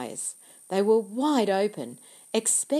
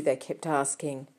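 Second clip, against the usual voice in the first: ordinary delivery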